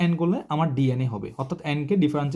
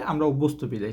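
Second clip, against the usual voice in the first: ordinary delivery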